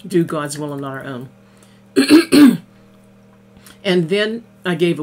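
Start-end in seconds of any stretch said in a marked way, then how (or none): 1.9-2.6 loud clearing of the throat. Real, not acted. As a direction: clear your throat before the next line. Clears throat before speaking.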